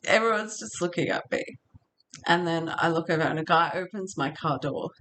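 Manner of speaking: usual speaking voice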